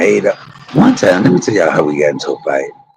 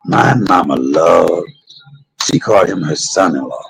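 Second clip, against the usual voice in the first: ordinary talk, nothing marked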